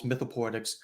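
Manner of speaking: ordinary talk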